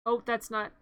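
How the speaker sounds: normal talking voice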